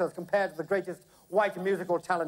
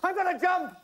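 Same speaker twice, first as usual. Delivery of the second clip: high-pitched frantic raving; hysterical outburst; 10 semitones higher than usual. A narrator's reading. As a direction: work yourself into hysterics over your idol. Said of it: "screamed Ginger hysterically".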